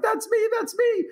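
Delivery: high, tearful gasp of a woman; emotional woman. Retold or imitated. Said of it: "a lady in the back of the room starts crying".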